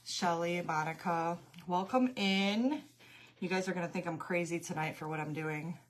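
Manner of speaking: ordinary delivery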